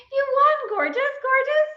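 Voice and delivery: very high, thrilled congratulations; squealing delight. Real, not acted. Congratulates the winner Elaine, squealing high.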